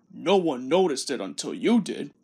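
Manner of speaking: low, grumbling big-engine grunt; gruff engine grumbling at a teasing rival; grunting Gordon. Acted. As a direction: grumble it out like a sulky heavy engine. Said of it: "grunted Gordon".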